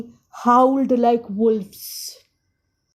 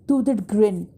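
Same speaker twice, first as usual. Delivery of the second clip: ordinary delivery, nothing marked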